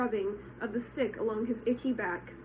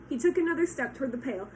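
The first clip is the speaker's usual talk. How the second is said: usual speaking voice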